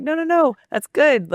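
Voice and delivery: high, quick no no no; eager reassurance. Retold or imitated. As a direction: wave off their apology with a lift. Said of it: "I'm like".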